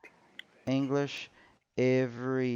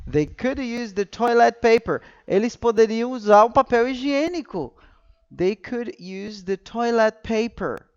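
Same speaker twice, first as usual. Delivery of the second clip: excited recap; 7 semitones higher, 7 dB louder than usual. Real, not acted. Excited repeat after joke.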